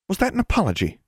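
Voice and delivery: high-pitched, affronted demand. A narrator's reading. Constance challenges Alexandra, demanding. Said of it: "Constance demanded".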